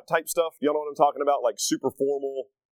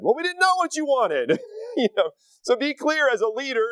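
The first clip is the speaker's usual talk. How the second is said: raised emphatic pitch; 9 semitones higher than usual. Real, not acted. Sons' protest, lively sermon point.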